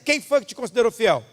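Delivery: ordinary delivery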